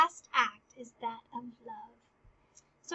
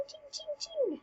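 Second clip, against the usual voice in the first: high clinking sword sound; sword clang noise; 14 semitones higher, 8 dB quieter than usual. Retold or imitated. Teacher imitating clashing swords, high ching sound. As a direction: make the blades ring. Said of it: "This noise it makes".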